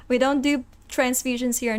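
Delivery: ordinary delivery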